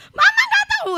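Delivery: high, loud imitation of an upset child; retold emotional outburst. Preacher imitating her child lashing out at mama, emotional high shout. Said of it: "dia emosional".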